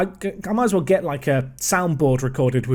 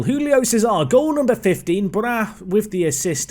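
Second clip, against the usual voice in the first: higher-pitched burst of celebration; excited goal call; 6 semitones higher than usual. Real, not acted. Gamer commentating on his own goal, excited.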